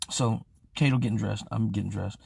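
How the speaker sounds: ordinary delivery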